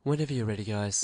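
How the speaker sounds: soft, low-key cue to actors; casual, calm director. Retold or imitated. Host mimics a first-time director cueing actors, casual and calm. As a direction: imitate a director gently easing everyone in. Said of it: "clearly wanting to keep a casual, calm vibe".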